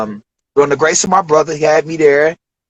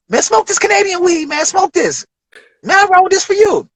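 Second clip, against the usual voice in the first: hyped-up Dave Chappelle; 14 semitones higher than usual. Retold or imitated. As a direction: sell the weed like a proud showman. Chappelle offering rolled blunt.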